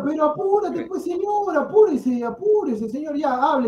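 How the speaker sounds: impatient retort. Real, not acted. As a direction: needle him to hurry up. Pundit fires back in argument. (impaciente y provocador, mucho más agudo)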